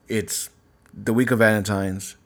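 ordinary talk, nothing marked

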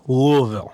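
"Louisville" as "Loo-a-vull"; local pronunciation. Shown as a demonstration. Panelist shows how locals say it, "Louisville" as "Loo-a-vull". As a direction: say "Louisville" with a swallowed middle "uh". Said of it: "you will pronounce it Louisville".

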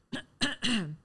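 imitated polite throat clearing; attention-getting throat clear. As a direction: clear your throat to get someone's attention. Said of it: "I hear this".

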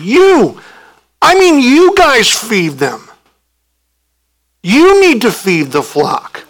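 pointing at the disciples, repeat YOU with force; emphatic command. Pretend. Preacher voicing Jesus insisting the disciples feed them, emphatic. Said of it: "And it's very emphatic".

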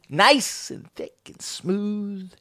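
very slow, soft and suggestive; sly innuendo. Retold or imitated. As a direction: stretch it out with a wink in the voice. Teasing innuendo about the roads.

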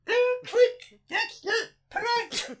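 high, slow put-on alien voice; captain impression. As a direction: squeak out a slow imitation of the captain. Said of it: "I can do the captain though".

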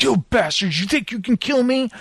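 imitating unhinged Deacon. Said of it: "he talks out loud to himself".

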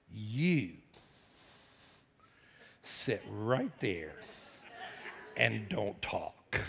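low, quiet, very slow command; unfazed stern order. Retold or imitated. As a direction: lay down the law softly, pausing between phrases. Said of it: "she just looked at me and she went".

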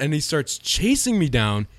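ordinary talk, nothing marked

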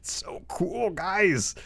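high whispered awe; overcome, barely getting the words out; high, hushed thrill; emotional whisper. Real, not acted. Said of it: "I'm getting really emotional about this".

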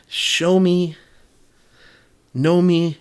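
praying aloud with a sudden lift; pleading prayer. Retold or imitated.

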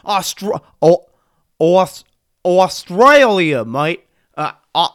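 fumbling, hammed-up Australian accent; failed Australian accent. Shown as a demonstration. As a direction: keep restarting a bad Australian accent, loud and drawn out. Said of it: "oh, I can't do it".

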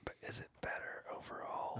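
unvoiced ASMR whisper. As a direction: breathe it into the mic. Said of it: "It's A S M R".

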